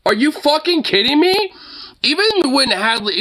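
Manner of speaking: high-pitched, incredulous outburst; outraged disbelief. Real, not acted. Reacting to the show quintupling down, incredulous and high. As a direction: stretch it out high, aghast. Reacting to the ruling being upheld.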